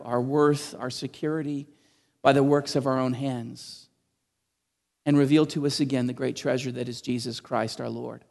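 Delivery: soft, low, reverent pastoral prayer. Acted. Pastor praying aloud for his congregation, soft and reverent.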